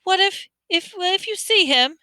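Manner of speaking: put-on nervous boy asking for help; timid boy voice. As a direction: stumble through it shyly. Neville voiced high.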